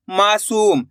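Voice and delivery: ordinary talk, nothing marked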